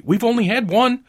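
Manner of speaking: loud, high, excited insistence; urgent reply. A narrator's reading. Wife reminding her husband of the wishes, high and excited. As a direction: push the point home excitedly. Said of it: "she replied rapidly".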